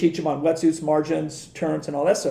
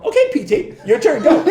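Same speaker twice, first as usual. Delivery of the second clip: high, louder laughing taunt; 9 semitones higher, 7 dB louder than usual. Retold or imitated. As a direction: needle him with a laugh, way up. Teasing Kelly at pinball.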